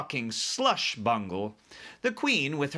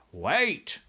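raised interrupting call; BFG crying out; 5 semitones higher than usual. A narrator's reading. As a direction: cut in with a higher cry. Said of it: "cried the B F G".

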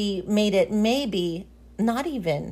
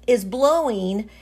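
ordinary talk, nothing marked

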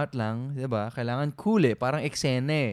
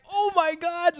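much higher, mock shocked cry; imitating the family going wild; excited shriek. Family reacting to the surprise proposal, high excited shriek.

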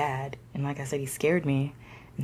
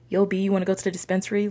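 higher, quick call to a buddy; eager invitation; 6 semitones higher, 4 dB louder, much faster than usual. Pretend. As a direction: toss out a lively, hurried invite. Imagined call to his friend.

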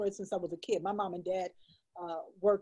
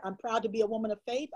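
ordinary talk, nothing marked

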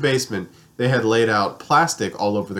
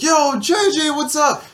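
imitating Brittany; slower than usual. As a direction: squeal it out like an excited friend. Friend Brittany greeting him again.

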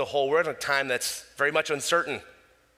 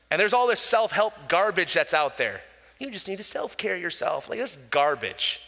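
normal talking voice

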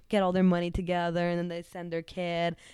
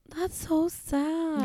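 long, high, aching sympathy; sad; 11 semitones higher, much slower than usual. Real, not acted. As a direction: stretch it slowly in sorrow. Reacting to the sad news.

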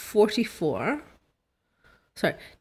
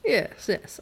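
plain reading voice

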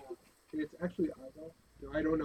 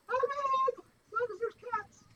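squeaky high voice; 6 dB louder, slower than usual. Acted. High squeaky in-character outburst.

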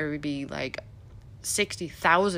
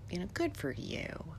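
sarcastic whisper; 10 dB quieter than usual. Real, not acted. Aside to those who escaped cold.

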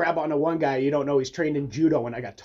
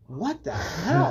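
slow, high-pitched disbelief; imitated drawn-out bafflement; mock amazement; 8 semitones higher, much slower than usual. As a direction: stretch it out as if stunned, rising at the end. Retells opponent's amazement.

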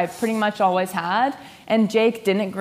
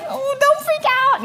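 high singsong calming; much higher mock soothing. Wife to fuming husband Jake, high mock soothing. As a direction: toss it off lightly, pretending calm.

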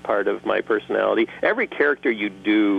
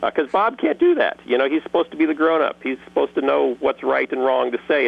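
ordinary talk

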